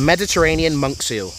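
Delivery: ordinary talk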